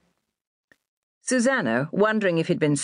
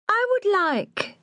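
higher, slow, lofty lady; haughty lady voice; slower than usual. A narrator's reading. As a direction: draw the words out like a snob.

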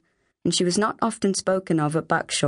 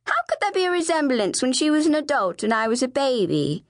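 put-on high, indignant child's question; upset challenge; 6 semitones higher than usual. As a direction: argue back as a distressed little sister. Flavia argues back, upset.

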